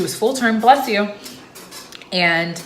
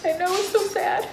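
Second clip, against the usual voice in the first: high, thin voice close to crying; tearful; 12 semitones higher than usual. Real, not acted. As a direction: squeak it out through welling tears. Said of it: "I'm gonna cry".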